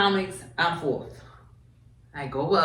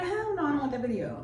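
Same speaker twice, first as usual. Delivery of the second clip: higher, mock-horrified hell no; flat refusal; 9 semitones higher, 6 dB quieter, faster than usual. Offered the video of the flop, higher mock horror. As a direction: refuse it with playful disgust. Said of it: "I'm like".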